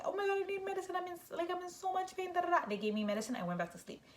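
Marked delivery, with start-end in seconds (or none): 0.0-2.7 high, squeaky mock whining; pleading in pain. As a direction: act out begging for relief in a high voice. Acting out begging for pain medicine.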